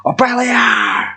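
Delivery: mock metal battle cry. Reviewer quoting the band Vikings of Sparta, mock battle-cry shout. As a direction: belt it like a band chant. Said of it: "if you listen to Vikings of Sparta".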